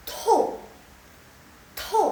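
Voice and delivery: "to" as tou, high, loud, falling fast; fourth tone. Shown as a demonstration. Teacher explains fourth tone, top-to-bottom fall. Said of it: "dia dari atas ke bawah".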